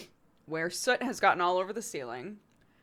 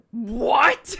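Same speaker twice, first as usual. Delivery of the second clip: alarmed what; 6 semitones higher, 9 dB louder than usual. Retold or imitated. Dad reacting to pigeon news.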